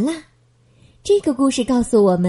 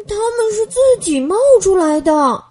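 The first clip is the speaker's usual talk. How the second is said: put-on higher, eager child voice; a boy quickly defending himself; hurried explanation; 8 semitones higher, 5 dB louder than usual. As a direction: rush to explain. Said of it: "乔治也赶忙解释".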